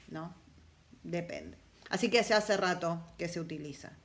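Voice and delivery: ordinary delivery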